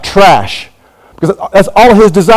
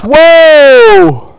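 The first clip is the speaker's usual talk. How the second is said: high, loud exclamation of wonder; a big astonished whoa; amazed whoa; 11 semitones higher, 4 dB louder than usual. Real, not acted. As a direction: let it burst out in awe. Amazed exclamation mid-sermon.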